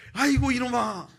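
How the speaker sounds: loud, high mock wail of dismay; acted senior's exasperated outburst; exasperated scolding. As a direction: cry out at a foolish junior. Senior scolds him after confession.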